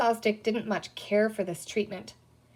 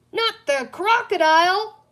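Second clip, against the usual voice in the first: high, loud panicked shriek; a pirate recoiling from the crocodile in panic; terrified cry; 10 semitones higher, 8 dB louder than usual. A narrator's reading. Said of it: "cried Captain Hook".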